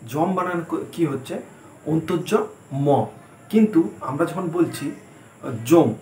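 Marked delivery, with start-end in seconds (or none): 5.7-5.9 জম said with a plain a vowel, as heard in Bengali; spoken pronunciation. Shown as a demonstration. Teacher shows students how জম really sounds. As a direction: say জম the Bangla way, plain a, no y-glide. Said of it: "এইরকম অনেকটা শুনতে লাগে".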